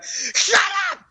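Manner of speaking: very high-pitched, cracking mad shriek; hammed-up furious screech at a rowdy class; imitated teacher's scream. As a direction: scream it like a teacher who has lost control. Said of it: "he would just scream".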